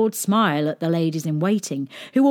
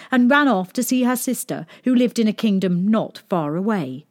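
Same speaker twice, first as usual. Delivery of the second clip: usual speaking voice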